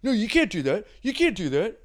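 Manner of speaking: a high, loud whiny rule-enforcer; rule stickler. Retold or imitated. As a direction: nag like a sore rules guy. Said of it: "one of those guys".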